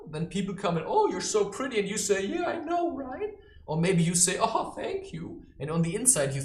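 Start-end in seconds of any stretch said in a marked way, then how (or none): 0.9-1.8 high, loud mock compliment; gushing flatterer. 2.3-3.3 high-pitched smug agreement; mock vanity. Acts out vain reply to flattery. 4.4-5.5 high, drawn-out syrupy thanks; fake gratitude. Acts out false humble thanks.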